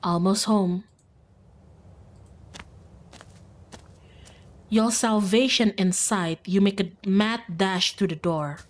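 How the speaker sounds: even, measured storyteller read; story narration. Acted. Streamer reading the narration of the dash home, measured. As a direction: narrate the danger steadily.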